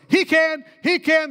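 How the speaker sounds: high, excited imitation of students; retold eager chorus; eager volunteering. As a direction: pipe up as a keen group would.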